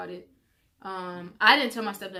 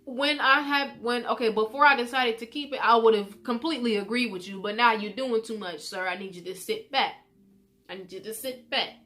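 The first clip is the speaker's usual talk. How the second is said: heated pushback; 3 semitones higher, 7 dB louder than usual. Retold or imitated. Arguing back at stepdad.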